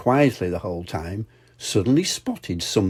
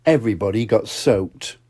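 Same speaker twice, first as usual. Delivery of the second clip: ordinary delivery, nothing marked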